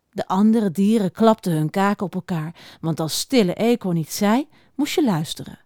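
plain reading voice

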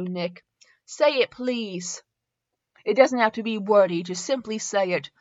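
measured, earnest read-aloud voice; story narration. Acted. Reading fan fiction aloud to listeners, story narration. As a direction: read the romance out evenly and sincerely.

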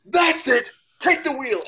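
high-pitched, exploding retold yell; mock losing-it shout; fed-up outburst. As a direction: snap and yell it, pitch shooting up.

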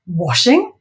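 loud disbelieving rise; suspicious Wendy. Acted. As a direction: question it with contempt. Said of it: "Wendy interrupted him again".

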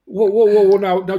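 loud, high burst of disbelief; acted startled objection. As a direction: halt them, mock-alarmed. Acted stunned objection to mother.

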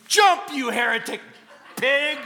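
hammed-up furious yell at the jumper; mock-furious laughing shout; mock outrage. Rescuer turns on jumper.

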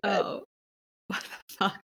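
soft, breathy laugh into the words; half-laugh, half-whispered shock; laughing disbelief. Real, not acted. Laughing disbelief at ex's remark.

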